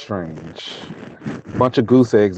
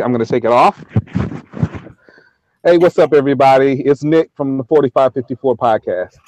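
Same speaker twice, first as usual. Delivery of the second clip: ordinary talk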